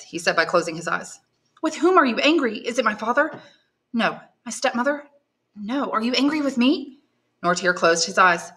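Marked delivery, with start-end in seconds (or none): 1.6-7.0 put-on higher, anxious questioning girl; young woman pleading, raised pitch; young granddaughter. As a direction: coax answers from a mute grandfather.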